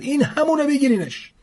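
a soldier spotting the culprit and calling out; soldier's shout. A narrator's reading. Soldier to his comrades, high shouted command. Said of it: "داد زد".

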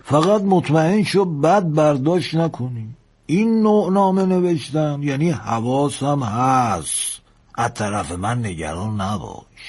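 put-on slow old engineer, high then settling; slow, emphatic old sailor's advice; old engineer, advising. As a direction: counsel the lad with drawn-out words.

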